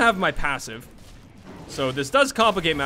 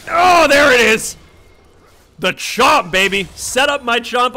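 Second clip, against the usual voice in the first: triumphant shout; 7 semitones higher, 9 dB louder, slower than usual. Real, not acted. Big play celebration.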